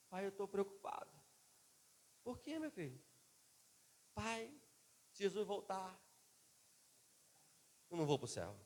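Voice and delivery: a hint of a small boy confessing fear; child voice. Son's worried words, voiced high. (leve voz de menino preocupado)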